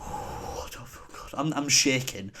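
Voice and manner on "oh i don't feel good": weak sick whisper; barely able to get it out. Retold or imitated. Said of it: "having to stop".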